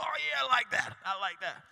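a hint of the father nodding along; approving dad. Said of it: "And he's like".

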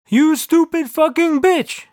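slow, high-pitched booming yell; God yelling. A narrator's reading. God scolding the narrator, slow acted yell. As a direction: stretch out every word of the insult. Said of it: "yelled God".

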